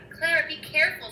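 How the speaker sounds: understated alarm; mild scream. A narrator's reading. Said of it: "screamed Cornelius".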